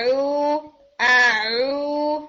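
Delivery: آ او drawn out slowly and pushed forward out of the mouth; a slow, open, correct ain sound; smooth ain demo. Shown as a demonstration. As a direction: let the ain flow out smoothly. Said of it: "بہت smoothly باہر نکالنا ہے".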